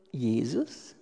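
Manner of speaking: low, soft, reverent "Jesus."; hushed answer. Acted. Preacher naming the only answer, low and soft. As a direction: drop the voice and give the name softly.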